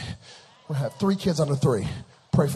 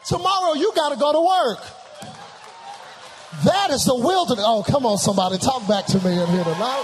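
loud, raised pulpit cry; full-force preaching; 7 semitones higher, 9 dB louder than usual. Acted. Preacher warns congregation about tomorrow, full-force preaching. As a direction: press the congregation for a response.

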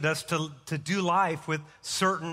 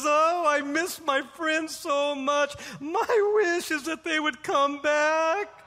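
high, slow, hammed-up wail; mock weeping; 10 semitones higher, slower than usual. Third stranded man begs the genie for his friends, mock weeping. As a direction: play a weepy man, drawing out the words far above your usual pitch. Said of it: "The guy is weeping".

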